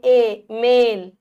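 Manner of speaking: मेल drawn out slow and loud on ए; slow stressed e. Shown as a demonstration. Teacher drawing out मेल to show the ए sound. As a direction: stretch ए in मेल. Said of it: "ए की ध्वनि आ रही है".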